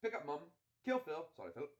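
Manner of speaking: brisk British film-hero voice; put-on British accent. Friend laying out a rescue plan, British accent.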